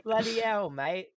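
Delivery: high, loud mock British; hammed-up Cockney shout; British accent. Joins English parody.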